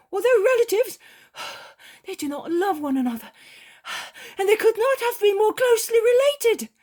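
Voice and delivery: high, loud, hurried alarmed report; Peter, urgent and breathless. A narrator's reading. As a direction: Peter bursts in with bad news. Peter reports the snake bite.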